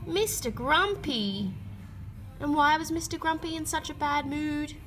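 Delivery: plain reading voice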